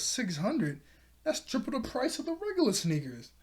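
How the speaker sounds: high-pitched disbelief at the price; mock incredulous. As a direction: balk at it, pitch climbing high. Buyer balks at the retold price.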